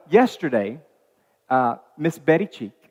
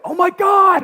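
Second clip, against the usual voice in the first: sudden high shout of "Oh my God"; excited gasp; 7 dB louder than usual. Retold or imitated. Pastor reliving opening the tickets, shouting with joy. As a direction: blurt it out, thrilled and stunned.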